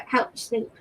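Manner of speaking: ordinary delivery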